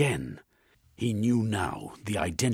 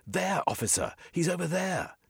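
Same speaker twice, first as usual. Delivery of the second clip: shouting; 12 semitones higher than usual. A narrator's reading. Jeremy shouts to the officer, pointing at the attacker. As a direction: call out, pointing.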